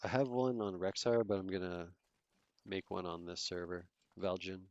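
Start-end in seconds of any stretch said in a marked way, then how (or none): none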